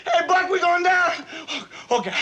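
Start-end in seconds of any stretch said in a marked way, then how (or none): none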